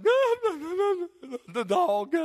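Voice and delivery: high, loud hysterical wailing; hammed-up distraught cry; mock frantic wail. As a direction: wail it out as an imitation of a panicked caller. Retelling wife's frantic phone call.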